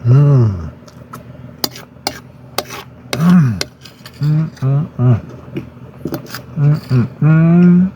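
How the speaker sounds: savoring hums while chewing; mouth full. Real, not acted. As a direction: hum through a mouthful of food. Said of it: "talk with my mouth full because I'm eating".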